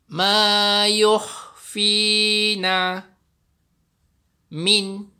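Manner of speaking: slow, drawn-out chanted recitation; Quran recitation. Shown as a demonstration. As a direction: recite it slowly in tajwid style. Recites verse.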